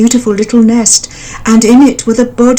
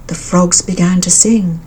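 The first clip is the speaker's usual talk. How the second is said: plain reading voice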